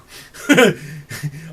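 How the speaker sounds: loud real laugh, breaking up; laughing fit after the jab; amused laugh. Real, not acted. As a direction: crack up at your own taunt. Laughs after taunting Hillary.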